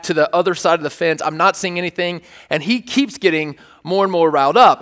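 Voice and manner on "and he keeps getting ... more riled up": ordinary delivery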